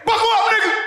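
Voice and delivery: very high, sudden holler; catchphrase shout. Acted. Cousin quitting the job, high-pitched shout. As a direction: fire it off way up high.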